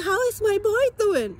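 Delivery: hairdresser asking. Retold or imitated. Hairdresser greeting the client about her baby, high and bright. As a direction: chirp it up high like the cheery stylist. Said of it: "You say".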